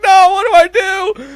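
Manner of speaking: very high, loud mock freak-out; comic panic. Storyteller reliving horn stuck while driving, hammed-up panic. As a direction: panic at the top of your voice, hammed up. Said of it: "I'm freaking out".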